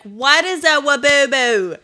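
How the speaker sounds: loud, high, drawn-out parental shout; exasperated yell. Retold or imitated. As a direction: yell it like a driver at the end of their rope. Stressed parent yelling at kid, retold.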